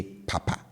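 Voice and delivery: "papa" with both syllables kept low. Shown as a demonstration. Teacher showing learners the second Twi word, low-low tone on "papa". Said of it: "I bring the tone on both syllables down".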